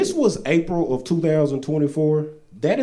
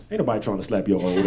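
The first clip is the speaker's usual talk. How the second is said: lower, fast, dismissive mumble; slapper's dismissive voice; 6 semitones lower, 3 dB quieter, faster than usual. Retold or imitated. The slapper at home scoffing at the old lady, low and fast. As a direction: brush her off with a low scoff.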